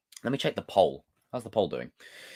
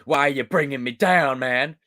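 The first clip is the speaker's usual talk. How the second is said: higher, hammed-up American dude; American accent; 5 semitones higher, 4 dB louder than usual. Shown as a demonstration. Streamer mock-answers the haters, hammed-up American dude. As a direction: plead in a put-on American accent. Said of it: "do more American accents".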